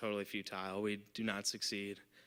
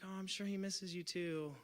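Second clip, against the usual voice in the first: high, soft sympathy; cooing consolation; 8 semitones higher, 7 dB quieter than usual. Retold or imitated. Partygoer consoling a girl missing her brother, cooing softly. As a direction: coo it like comforting someone small.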